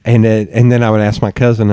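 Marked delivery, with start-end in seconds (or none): none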